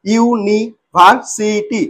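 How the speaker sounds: ইউনিভার্সিটি very slowly as ইউ, নি, ভার, সি, টি; split into syllables. Shown as a demonstration. Teacher giving a polysyllable example, ইউনিভার্সিটি said syllable by syllable. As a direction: pause after each syllable of ইউনিভার্সিটি. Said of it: "ভেঙে পড়ছি কিরকম".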